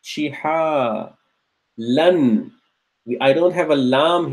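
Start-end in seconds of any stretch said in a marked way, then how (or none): 0.0-2.5 syllable by syllable. Shown as a demonstration. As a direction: break Fi'a, shiha, lan into separate beats. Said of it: "then there's an alif".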